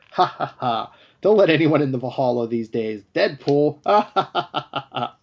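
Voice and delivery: imitated warriors' big ha-ha laughing; mock hearty laughter. Valhalla warriors joke about Deadpool, mock hearty laughter. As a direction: laugh it up like a mocking hall of warriors.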